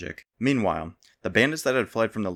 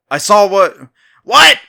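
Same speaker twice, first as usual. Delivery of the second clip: much higher and much louder shouted repeat; 11 semitones higher, 17 dB louder, slower than usual. A narrator's reading. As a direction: shout it back, straining to hear. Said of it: "the stranger repeated".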